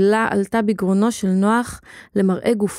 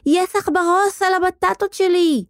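put-on high-pitched girl's screech; נוצי screeching; 10 semitones higher than usual. A narrator's reading. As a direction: shriek in alarm, very high. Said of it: "צרחה נוצי". (צווחה גבוהה של ילדה)

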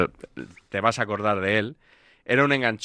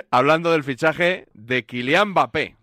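slow, lifted showman's announcement; mock-grand introduction, pitched up; award announcer; 4 semitones higher, 4 dB louder than usual. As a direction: draw out the big reveal. Announcing the winning clip.

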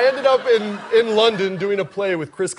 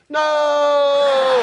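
mock scream; 6 semitones higher than usual. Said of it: "I can hear this poor bastard scream".

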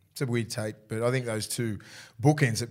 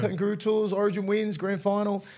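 teasing taunt; 7 semitones higher than usual. Retold or imitated. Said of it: "I'll be always like".

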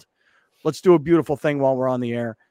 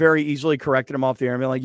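normal talking voice

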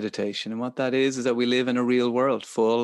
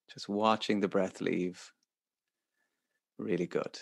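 soft, calm meditation guidance; guided meditation; 4 dB quieter than usual. Acted. Leads a breathing meditation.

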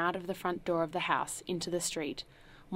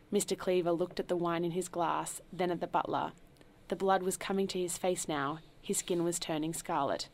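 usual speaking voice

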